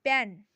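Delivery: पेन with a firm, loud प; sounding out pen. Shown as a demonstration. Teacher sounding out पेन for students. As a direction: stress the प in पेन clearly. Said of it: "इसका उच्चारण भी देखो".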